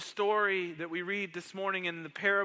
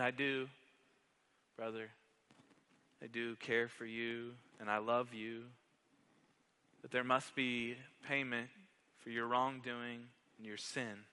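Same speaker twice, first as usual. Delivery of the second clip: gentle reassurance; 3 semitones lower, 6 dB quieter than usual. Acted. Older brother's gentle reply in cell.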